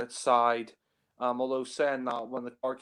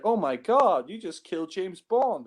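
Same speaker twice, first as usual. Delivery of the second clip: higher-pitched imitation of a shocked woman; mock shock; 7 semitones higher than usual. Reviewer quoting the Bond girl on the killing, mock shock. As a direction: gasp it out in hammed-up astonishment.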